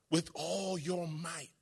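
slow, hushed repeat of the phrase. Shown as a demonstration. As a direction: drop the voice to almost nothing. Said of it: "repeated much softer".

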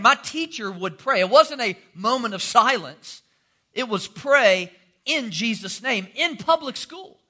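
usual speaking voice

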